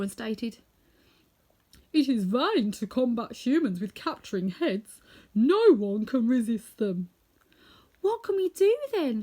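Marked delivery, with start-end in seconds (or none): 1.9-7.1 slow, heavy, bruised lion; weary lion, last words slower. A narrator's reading. Bruised Lion tells Dorothy it is hopeless: slow heavy voice. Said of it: "feeling rather bruised and sore". 8.0-9.2 put-on much higher, louder childlike plea; high girl voice. A narrator's reading. Dorothy asks the others what to do: high childlike. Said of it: "she requested".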